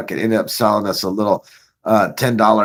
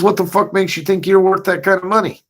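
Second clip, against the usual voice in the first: higher-pitched, mock-angry boss challenge; 9 semitones higher than usual. Boss scoffing at job applicant, mock angry. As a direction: scoff at the new guy like the boss did. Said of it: "He says".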